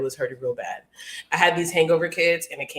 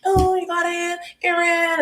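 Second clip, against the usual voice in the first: much higher, sweet and bright, imitating the friendly cashier; 12 semitones higher, slower than usual. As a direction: play the nice girl at the counter, chirpy. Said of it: "She was like".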